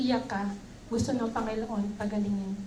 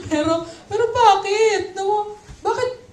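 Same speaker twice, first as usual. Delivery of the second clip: higher, louder hurt plea with a laugh breaking through; hurt pleading Hagar; 9 semitones higher, 5 dB louder than usual. Acted. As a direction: play the wronged servant asking why, half laughing. Voicing Hagar's hurt complaint. (Hagar na nagtatampo, mas mataas at malakas ang boses)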